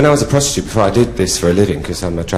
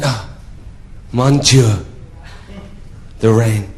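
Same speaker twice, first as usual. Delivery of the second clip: mock French count exclaiming; French accent; slower than usual. French count sprinkled with water, exclaiming in a French accent. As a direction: savour it in a put-on French accent. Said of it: "he said to me in a French accent".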